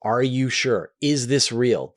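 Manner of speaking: ordinary talk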